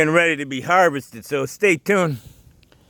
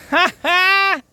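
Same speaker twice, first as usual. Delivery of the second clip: shrill laugh, much higher; high cackle; 4 dB louder than usual. Real, not acted. Laughs at storm damage.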